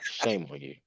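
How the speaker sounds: breathy whispered tut-tut; whispered scolding. Pretend. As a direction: whisper it like a playful reproach.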